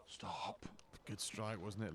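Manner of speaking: hushed golf whisper. Real, not acted. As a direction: breathe it out under the shot. Whispered during the shot.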